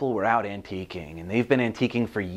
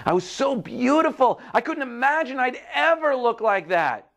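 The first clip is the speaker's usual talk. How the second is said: high-pitched wonder; amazed teacup; 12 semitones higher than usual. Acted. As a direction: marvel at your own reflection in storybook style.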